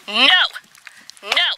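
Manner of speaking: sharp high commands to the dog. Real, not acted. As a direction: stop the dog firmly. Dog owner scolding dog at snake.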